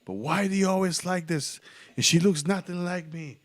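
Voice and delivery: high, loud mock girlfriend complaint; imitated upset girlfriend. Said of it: "And she's like".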